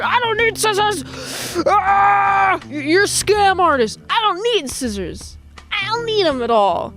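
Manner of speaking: high-pitched, mock outraged customer; acted indignant protest. Bar customer calls peddler a scammer, mock indignation. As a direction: protest like a comically affronted victim.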